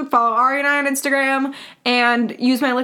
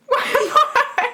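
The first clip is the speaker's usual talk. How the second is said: laughing; 12 semitones higher than usual. Real, not acted. Laughing at a funny comment.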